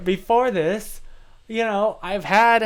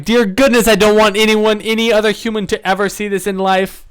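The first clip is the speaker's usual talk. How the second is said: much louder, exasperated wail; horrified at watching her own footage; mock horrified outcry; 3 semitones higher, 9 dB louder than usual. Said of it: "I'm like".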